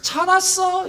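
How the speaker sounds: high-pitched, affectionate welcome; acted fond reunion voice; welcoming. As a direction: greet someone long missed, gushing a little. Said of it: "이러시는 게 아니라".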